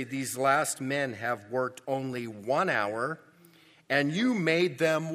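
slow, loud, aggrieved protest; grumbling laborers to the landlord, slow and loud; resentful complaint. Retold or imitated. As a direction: drag it out like a wronged worker. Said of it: "What are they saying?".

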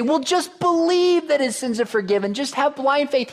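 high, put-on pleading voice; mock plea. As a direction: push it up high, a hammed-up appeal. Voices what Jesus didn't say.

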